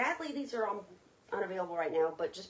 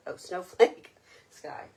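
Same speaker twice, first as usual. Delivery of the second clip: laughing; 10 dB louder, slower than usual. Real, not acted. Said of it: "I read that funny".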